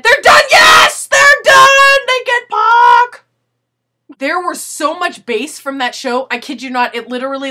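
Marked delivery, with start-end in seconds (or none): 0.0-3.2 high, loud, slow cheering, half-sung at the end; jubilant shout. Retold or imitated. Cheering that the band is done so [name] comes, loud jubilant shout. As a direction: celebrate like the torture is finally over.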